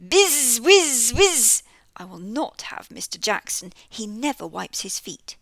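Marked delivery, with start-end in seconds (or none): 0.0-1.6 loud high bee buzz. Acted. Narrator buzzing like the bees in the nest.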